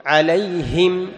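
loud, slow عليهم with pure "hi"; deliberate عليهم, full i after the Ha; clear Ha kasra. Shown as a demonstration. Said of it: "بيان وتصفية كسرة الهاء".

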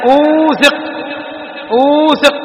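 "أوثق" with a long drawn-out "uu"; مد الواو. Shown as a demonstration. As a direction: stretch the و in "أوثق": uuu-thiq. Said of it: "وكذلك". («أوثق» بمد الواو)

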